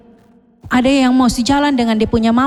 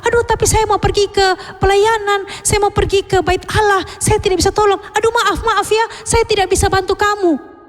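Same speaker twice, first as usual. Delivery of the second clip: priest excusing himself; 7 semitones higher than usual. Acted. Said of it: "Dia bilang".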